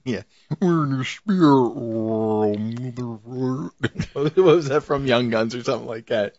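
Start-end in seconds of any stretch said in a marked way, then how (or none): 0.6-3.7 slow, drawn-out mock mystic voice; movie quote voice. Quoting a trippy film scene to a co-host, slow put-on voice. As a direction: draw out a film quote slowly.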